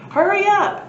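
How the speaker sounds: high, loud call upward. Acted. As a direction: call up urgently. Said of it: "he called".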